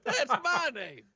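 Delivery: high, folksy drawling prisoner; chatty, smug old codger; Jorge voice. Acted. Jorge explains the prisoners' departure, high and folksy.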